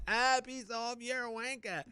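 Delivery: high, rough mock heckle; mocking yob voice; yobbo heckler. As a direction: sneer it like a drunk fan. Rude crowd yelling abuse.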